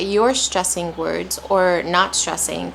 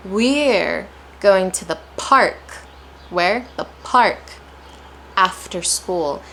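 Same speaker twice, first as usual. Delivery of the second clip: slow, with "We're" and "park" punched and "After" set apart; stressed key words; slower than usual. Shown as a demonstration. Teacher breaking a sentence into chunks for learners, stressed slowly. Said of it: "notice I'm also playing stress".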